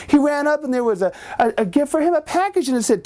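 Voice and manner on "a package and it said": ordinary delivery, nothing marked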